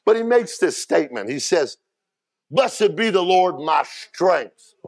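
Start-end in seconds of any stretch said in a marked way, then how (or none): 2.5-4.7 fervent psalm reading. Acted. Reads David's psalm with fervour.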